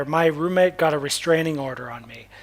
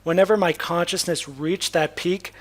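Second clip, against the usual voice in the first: ordinary talk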